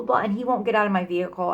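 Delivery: ordinary delivery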